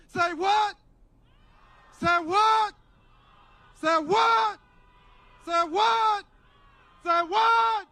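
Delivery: high, loud repeated call to the crowd; shouted crowd cue. Real, not acted. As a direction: whip up a chant, building as it repeats. Cueing crowd to shout vote.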